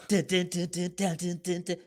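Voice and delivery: imitating scary music. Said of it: "evil villain music".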